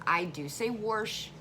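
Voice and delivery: wash said slowly as warsh with an added r. Shown as a demonstration. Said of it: "if I'm talking fast".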